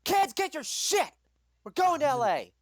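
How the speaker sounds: high, loud mom yell; angry mother calling through the house; shouting to kids. Acted. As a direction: holler down to the kids. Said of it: "shouts down to the kids".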